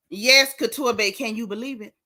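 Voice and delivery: usual speaking voice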